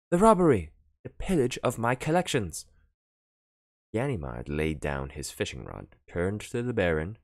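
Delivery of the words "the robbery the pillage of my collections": sudden high, loud cry of alarm; agitated outburst. Acted. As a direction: blurt it out in panic about the robbery. Baron alarmed about the robbery.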